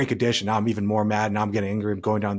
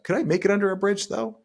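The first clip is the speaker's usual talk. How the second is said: wry questioning; 9 semitones higher than usual. Retold or imitated. Questioning his own catastrophizing.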